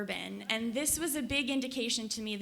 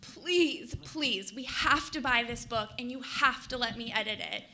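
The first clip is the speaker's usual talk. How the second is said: begging; 3 dB louder than usual. Retold or imitated. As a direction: plead eagerly, leaning on the key words. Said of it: "and begged Nan Graham".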